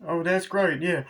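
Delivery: high, loud silly sheriff voice; imitating the sheriff from the movie, high and loud; mock sheriff. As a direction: ham up a dopey small-town sheriff. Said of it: "where the sheriff's like".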